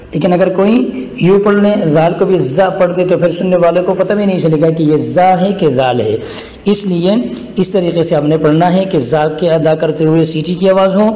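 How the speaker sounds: normal talking voice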